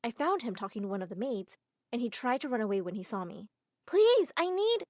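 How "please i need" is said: high, loud begging; urgent plea. Acted. Girl urgently begging the fleeing assistant. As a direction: plead in a rush.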